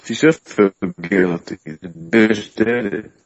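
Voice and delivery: slow, dragged-out delivery. Real, not acted. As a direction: stretch the words out sluggishly. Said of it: "Your voice is really going slow".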